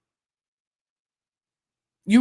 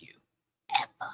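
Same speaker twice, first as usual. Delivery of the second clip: soft, tearful whisper; 9 dB quieter, faster than usual. Real, not acted. Emphatic life advice.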